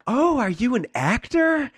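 very high, loud, drawn-out squeal of interest; hammed-up, slow, high-pitched excitement; mimicked gushing stranger. Stranger in elevator to sick auditioner, imitated as shrieky gushing.

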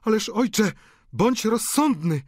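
high, urgent daughters' plea; alarmed high-pitched remonstrance; women calling out. A narrator's reading. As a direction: implore your father in a high voice. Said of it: "wołały obie kobiety".

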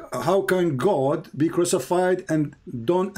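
ordinary delivery

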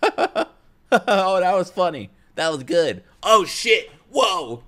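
high, hammed-up fake excitement, slow on "that was funny"; mock reaction-channel voice. Mocking other reaction channels, hammed-up fake excitement. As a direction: overact like a cheesy reaction channel. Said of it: "They're just like".

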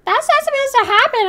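high put-on voice quoting the crowd; high mock-chorus voice; mocking everyone. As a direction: mimic the chat in a squeaky voice. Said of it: "and everyone's like".

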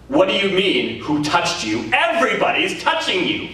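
incredulous disciples. Retold or imitated. Disciples answer Jesus in crowd.

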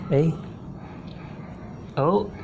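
usual speaking voice

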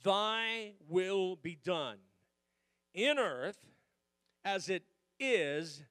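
slow, drawn-out scripture reading; solemn scripture reading. Shown as a demonstration. Pastor reading Matthew six to the church, slow and solemn. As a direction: let each phrase of the verse hang. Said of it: "here's my scripture".

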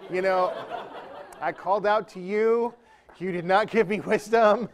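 comic wounded protest; mock reproach. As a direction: scold heaven for letting you down. Mock complaint to the Holy Spirit.

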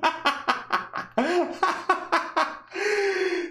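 long high cackling laugh; real laughing fit at chat; high wheezing laugh. Real, not acted. Cracking up at chat.

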